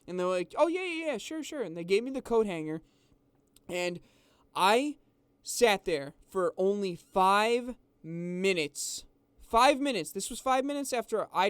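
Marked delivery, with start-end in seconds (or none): 0.6-1.6 high, overly eager, airy yes-yes voice; mock the staff's eager agreement, pitched way up; mocking eager employees. Mocking the McDonald's staff who hand back the hanger, high and eager. 9.4-10.1 Five punched higher and louder; stress on five. Real, not acted. Telling viewers how fast he unlocked the car, stressing Five.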